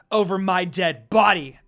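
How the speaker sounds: loud furious yell. A narrator's reading. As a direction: yell it, struggling to break free. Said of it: "Kagome yelled once more".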